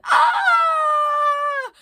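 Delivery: high, loud shouted scream; a big falling yelp; crew screaming. Acted. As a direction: everyone screams as they slip. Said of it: "Everyone went".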